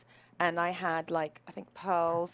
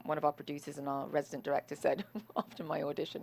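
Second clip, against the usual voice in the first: ordinary talk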